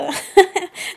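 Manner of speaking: short high laugh; amused laugh. Real, not acted. Admitting there is no indoor toilet, amused laugh.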